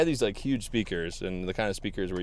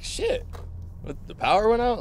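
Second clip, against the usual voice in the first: startled; 14 semitones higher than usual. Retold or imitated. Lights suddenly go out at party.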